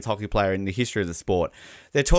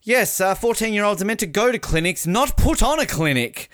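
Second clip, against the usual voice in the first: commentator quote; 7 semitones higher than usual. Retold or imitated. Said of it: "basically said".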